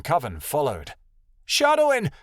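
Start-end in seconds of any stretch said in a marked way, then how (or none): 1.5-2.1 high, loud cry after someone. A narrator's reading. Jade cries after her brother as he runs, high and loud. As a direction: yell the name at a high pitch. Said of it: "Jade cried".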